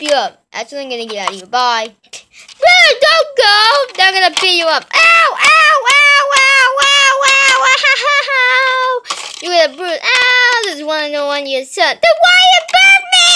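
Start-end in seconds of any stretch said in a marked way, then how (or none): 2.6-4.8 pleading shout. Acted. Warning brother, shouting. 4.9-13.3 much higher, louder mock wailing ows; fake-cry in pain, overdone; hammed-up crying. Son being whooped by dad, hammed-up wails.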